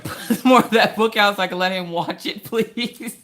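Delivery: high, giggly pleading through laughter; laughing. Real, not acted. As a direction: plead while cracking up.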